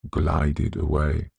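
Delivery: usual speaking voice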